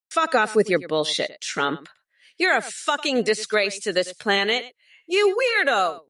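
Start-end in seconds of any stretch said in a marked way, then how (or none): 5.1-6.0 much higher sneering jeer; high mocking yell. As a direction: taunt the opponent. Mocking insult, big pitch jump.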